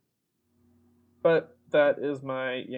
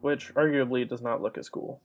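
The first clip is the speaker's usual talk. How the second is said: normal talking voice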